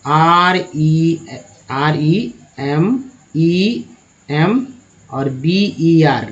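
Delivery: letters of "R E M E" spelled out very slowly; teacher dictating letters slowly; slow spelling. Shown as a demonstration. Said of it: "और भी clear करने के लिए".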